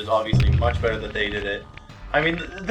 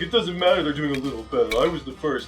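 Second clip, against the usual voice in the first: mock salty self-quote; 3 semitones higher than usual. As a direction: imitate yourself being a sore loser. Quoting his past salty self.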